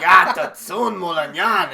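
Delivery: loud, slow Italian-style cursing; drawn-out mock Italian insult; mock Italian swearing. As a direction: spit it out as a hammed-up Italian curse. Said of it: "she, like, swears in Italian".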